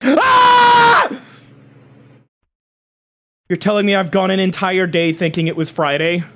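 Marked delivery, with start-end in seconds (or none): 0.0-1.1 high, loud sustained scream. Real, not acted. Streamer learns it is Saturday, high scream. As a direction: scream out on one high held note.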